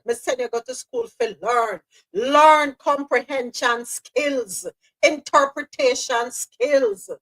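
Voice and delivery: imitating a Caribbean parent scolding, slowing at the end. Caribbean parent scolding child about school, mock accent. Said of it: "As our parents would say".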